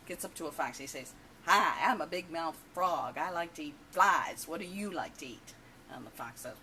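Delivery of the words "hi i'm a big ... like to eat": big brash joke-character voice; obnoxious big-mouth frog. Acted. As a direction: play an obnoxious frog, mouth wide open, booming out each phrase. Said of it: "and he's obnoxious".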